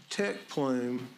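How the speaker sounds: ordinary delivery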